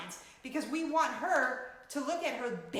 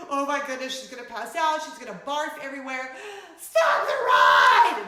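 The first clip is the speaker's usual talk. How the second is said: louder, rising to a shout at the end; panicked plea; 2 semitones higher, 11 dB louder than usual. Retold or imitated. Mom reliving panic as her daughter rides. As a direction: build the fear until you yell. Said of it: "and I'm just like".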